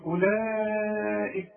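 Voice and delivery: أولئك with the long vowel held four counts, slow; four-beat madd. Shown as a demonstration. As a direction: stretch it four beats. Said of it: "الأربع حركات". (مد أربع حركات في أولئك)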